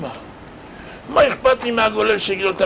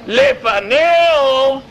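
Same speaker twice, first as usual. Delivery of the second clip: לפניהו stretched slow and high, louder; stressed drawn out; 6 semitones higher, 4 dB louder, much slower than usual. Shown as a demonstration. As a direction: draw out לפניהו slowly. Preacher stresses before/after contrast. (לפניהו נמשך לאט ובגובה)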